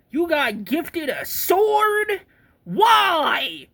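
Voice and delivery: high, slow, stretched disbelief; incredulous. Retold or imitated. Friend baffled to hear about a gifted sword. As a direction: drag it out, baffled.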